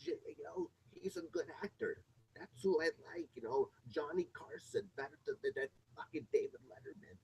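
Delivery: put-on high, whispered old lady voice; imitating grandma. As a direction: mimic grandma, hushed and high-pitched. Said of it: "she used to say".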